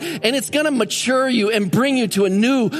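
high, driving sermon climax; full-force preaching. Acted. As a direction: preach it with rising fervour.

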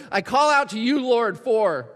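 urgent prayer cry; calling out to God. Pretend. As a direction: lift the voice toward God. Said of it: "it might sound like".

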